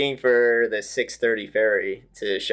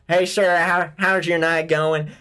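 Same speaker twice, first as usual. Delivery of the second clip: imitating a Southern accent; 6 semitones higher than usual. Drunk friend asks a firefighter for a hospital ride, hammed-up Southern accent. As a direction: play the drunk buddy going full Southern on the firefighter. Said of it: "oh gosh, I gotta try to do this".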